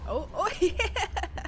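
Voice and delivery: slow laughing whoop of delight; giddy laughing cheer. Real, not acted. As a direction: laugh through a gleeful yeah. Delighted as the fire spreads.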